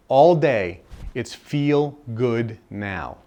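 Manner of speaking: drawn out, very slow key line; slow, deliberate insistence; slow emphasis. Real, not acted. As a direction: spell out the message slowly. Key message slowed.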